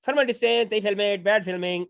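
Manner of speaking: playful South Indian accent imitation. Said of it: "sounds exactly like my dad".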